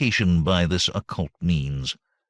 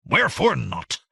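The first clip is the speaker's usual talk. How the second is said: a touch of a sharp jab, higher; jabbing demand; 7 semitones higher than usual. A narrator's reading. Master jabbing the question at his servant, sharp. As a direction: stab the question at her. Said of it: "voice suddenly jabbed like a thrown knife".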